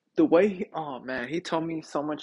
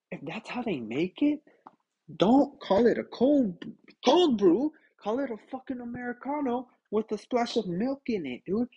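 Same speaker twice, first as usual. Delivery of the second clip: retold indignant rant, higher pitched; angry; 7 semitones higher than usual. Customer complaining about a fake cold brew, angry. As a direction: lay into them over the wrong drink. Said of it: "I was angry, dude".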